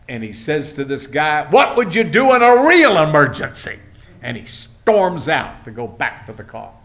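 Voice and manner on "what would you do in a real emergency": loud, high exasperated demand; retold outburst at the end of his patience; fed-up outburst. Fed-up friend to the station attendant, exasperated outburst. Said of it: "my friend had had it".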